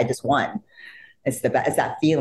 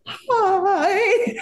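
high, squeaky starstruck greeting. Retold or imitated. Fan meeting her idol, squeaky starstruck hi.

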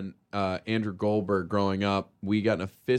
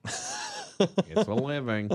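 cartoon animal voice; 3 semitones higher, much slower than usual. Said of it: "Is that what he said?".

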